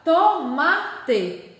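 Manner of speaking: "tomate" slowly demonstrated, ending in a clear teh; stress on MA. Shown as a demonstration. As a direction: sound out "tomate" syllable by syllable, strong MA. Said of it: "minha sílaba de tom mais forte".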